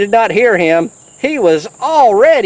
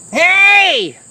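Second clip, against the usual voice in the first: higher shouted "Hey!"; yell; 9 semitones higher than usual. Acted. Angry camper yells at George for dousing the fire. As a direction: call out to stop him. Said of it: "yelled a camper".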